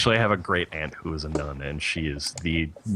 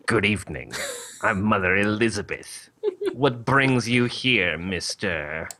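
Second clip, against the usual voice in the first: nun voice. Acted. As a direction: play a formal old-school nun, drawing out the question. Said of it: "This is the best nun voice".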